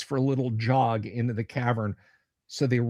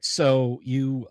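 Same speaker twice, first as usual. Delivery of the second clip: ordinary talk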